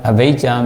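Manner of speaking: "avijja" as ah-VAY-jah, with v and j; international pronunciation. Shown as a demonstration. Teacher naming the term for students, international pronunciation of "avijja". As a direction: give "avijja" its international v and j sounds. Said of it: "this is international pronunciation".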